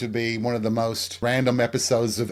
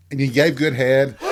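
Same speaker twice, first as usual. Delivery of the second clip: naughty joke; 4 dB louder than usual. Acted. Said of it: "I'm joking".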